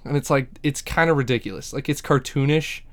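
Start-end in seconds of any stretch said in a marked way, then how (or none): none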